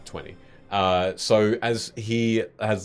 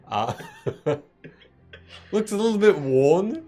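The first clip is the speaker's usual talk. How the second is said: stifled laughter; 6 semitones higher, 5 dB louder than usual. Real, not acted. GM describing sack, suppressing laughter.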